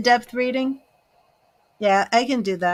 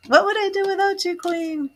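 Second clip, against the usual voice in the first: fast, high, delighted gush; gushing thanks; 10 semitones higher than usual. Real, not acted. Host thanking a loyal viewer, high fast gush. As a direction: burst out with grateful delight.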